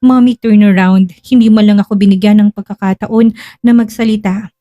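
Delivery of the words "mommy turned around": plain reading voice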